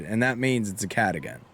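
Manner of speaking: normal talking voice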